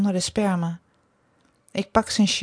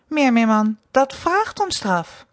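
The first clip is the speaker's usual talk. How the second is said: raised, louder scolding tone; stern mistress drawing out the threat; sharp, biting; 5 semitones higher, 5 dB louder, slower than usual. A narrator's reading. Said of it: "bits ik hem toe". (bits, bestraffend)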